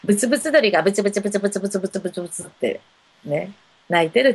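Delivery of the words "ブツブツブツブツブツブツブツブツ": imitated muttering.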